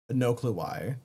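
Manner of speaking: ordinary talk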